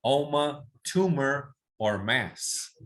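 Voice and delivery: oma, tumor or mass, said slowly for repeating; slow model drill. Shown as a demonstration. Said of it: "todos repiten". (dictado lento para repetir)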